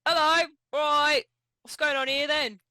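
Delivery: loud shouted greeting. Acted. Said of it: "I shout over and go".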